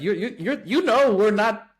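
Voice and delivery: retelling a plea to the bankers, high and exasperated. Retold or imitated. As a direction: insist you're no money launderer, half laughing in disbelief. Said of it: "we told our bankers, like".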